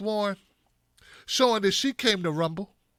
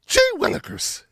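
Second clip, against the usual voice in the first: much higher, wide-eyed gee-whiz voice; high folksy Warren; 12 semitones higher than usual. Retold or imitated. Mocking Warren exclaiming as she steps in, high hokey voice. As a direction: go way up like a corny schoolmarm.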